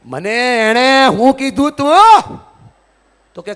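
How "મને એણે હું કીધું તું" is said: slow, louder, higher sulky uncle voice; whiny sulking relative. Retold or imitated. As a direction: drag it out like an offended elder.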